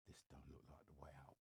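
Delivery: quick, soft whisper to himself; inner thought whispered. Retold or imitated. Walking through the building, realising it isn't the exit, whispered fast. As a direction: whisper the doubt under your breath, quickly. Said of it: "and you know when you think".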